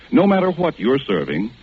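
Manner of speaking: usual speaking voice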